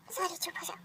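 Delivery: hushed pleading whisper. Acted. Boyfriend hushing his crying girlfriend, whispering. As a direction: whisper softly to calm her.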